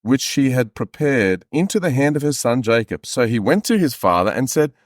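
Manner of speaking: usual speaking voice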